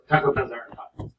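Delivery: quick sample line in mock Irish accent; attempted Irish accent. Shown as a demonstration. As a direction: show someone a mild Irish accent. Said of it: "can you do an Irish accent".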